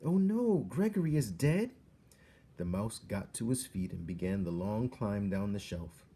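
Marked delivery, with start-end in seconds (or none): 0.0-1.7 shocked. A narrator's reading. Despereaux shocked.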